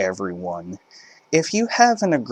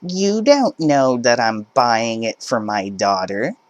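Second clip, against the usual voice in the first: entitled mother; 3 semitones higher than usual. Acted. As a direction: push back like a pushy parent. Entitled mother arguing at checkout.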